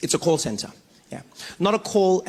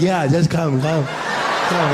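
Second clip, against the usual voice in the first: dog talking; 3 semitones lower, much slower than usual. Retold or imitated. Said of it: "And the dog's like".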